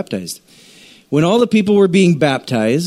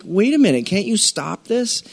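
pitched up, pleading "wait a minute"; a light hint of a frightened disciple; anxious plea; 7 semitones higher than usual. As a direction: plead with him not to go.

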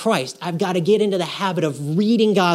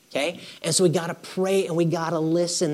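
ordinary talk

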